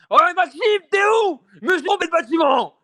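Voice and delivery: put-on high, loud distant drunken call; mock drunk yelling from outside. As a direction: shout up from the street. Drunk shouting outside, retold.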